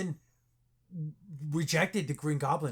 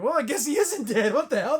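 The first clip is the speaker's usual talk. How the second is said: high, loud startled exclamation; mock surprise; 8 semitones higher, 7 dB louder than usual. Friend retelling a movie scene, high loud surprise. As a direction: jump up in pitch and volume, startled. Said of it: "he's like".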